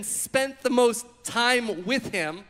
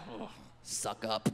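much lower, softer scornful mutter; scornful sneer; 12 semitones lower, 11 dB quieter than usual. Retold or imitated. Classmates sneering at the teacher's pet, low and scornful. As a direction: sneer it under your breath. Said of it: "the rest of the class is like".

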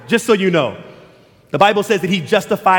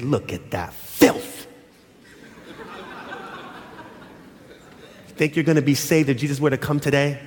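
disapproving mom; 5 semitones lower, 5 dB quieter than usual. Retold or imitated. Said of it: "and she said".

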